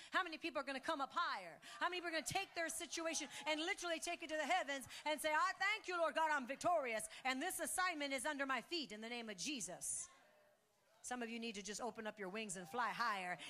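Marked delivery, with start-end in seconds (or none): 0.1-10.1 loud, high, fast rallying call; preaching climax. Acted. Pastor calling the church to declare victory, loud fervent. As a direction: rouse the crowd to declare victory.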